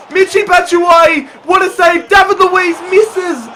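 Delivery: screaming at the screen; frantic shout. Real, not acted. Gamer screaming as late chances are missed, frantic shout.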